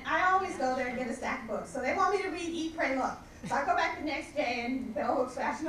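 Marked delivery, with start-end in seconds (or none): none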